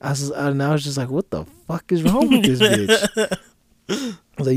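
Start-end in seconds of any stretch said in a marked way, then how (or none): 1.1-3.4 high, drawn-out exasperation; baffled outburst, stretched out; exasperated disbelief. Retold or imitated. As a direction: retell it in loud, incredulous dismay. Retelling his exasperated reaction.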